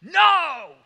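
loud, frustrated shout back. Retold or imitated. Disciples yelling back to Jesus, loud frustrated no. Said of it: "frustration in their voice".

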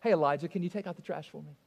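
quick, low, easygoing ask; softened fatherly request; nicer, friendly request. Acted. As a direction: ask casually, as a kindly favour. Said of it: "That's much nicer".

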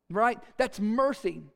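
ordinary delivery

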